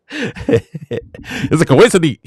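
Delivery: drawn out through laughter; mock the doubter while laughing; slow, giggly dismissive quote; laughing mockery.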